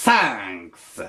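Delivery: thanks with a lisping th; slushy lisp. Shown as a demonstration. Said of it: "Toujours avec le cheveu sur la langue".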